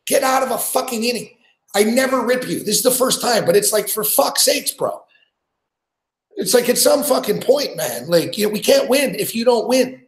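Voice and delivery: high-pitched, frustrated rant at the pitcher; exasperated yelling. Real, not acted. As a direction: chew out your own ace like he can hear you. Fan yelling at his pitcher on TV.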